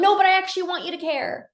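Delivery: acted-out relationship complaint, high and fast; pleading protest. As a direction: blurt it like an exasperated partner.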